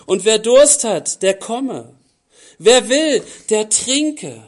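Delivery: loud, ringing call to come; preaching call. Acted. Preacher calling the thirsty to come and drink, loud and ringing. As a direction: call out the invitation to the whole hall.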